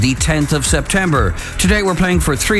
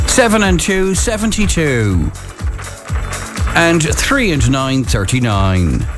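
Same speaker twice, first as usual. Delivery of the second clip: bingo caller; slower than usual. Acted. Calling bingo numbers on air.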